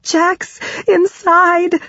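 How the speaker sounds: high, slow, broken-up cry; distraught acted cry, drawn out; panting and tearful. A narrator's reading. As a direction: force it out between panicked breaths. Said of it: "between my panting breaths and tears".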